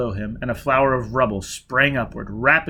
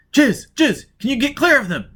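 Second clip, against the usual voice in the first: raised, higher urgent call; anxious call; 12 semitones higher, 6 dB louder than usual. Acted. Foyle calling Jiz as she is surrounded, urgent. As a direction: call out to her in alarm.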